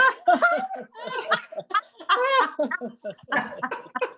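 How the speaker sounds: high, prolonged belly laugh; big exercise guffaw; released laughter. Shown as a demonstration. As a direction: burst out and keep laughing. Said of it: "And then go".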